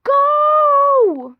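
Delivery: frantic high shouts; shouting. Real, not acted. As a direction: yell at them to run. Urging a fleeing player.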